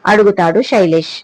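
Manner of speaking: plain reading voice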